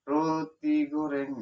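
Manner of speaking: high, loud, drawn-out selling cry; vendor's call. Retold or imitated. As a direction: call out your bread, stretching the vowels. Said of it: "Kemudian saya bilang".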